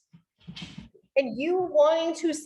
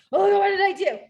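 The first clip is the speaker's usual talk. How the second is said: higher, louder mock alarm; mock panic; 7 semitones higher, 5 dB louder than usual. Men reacting to a slammed cabinet, mock panicked. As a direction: imitate a worried husband fretting. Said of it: "They have this like".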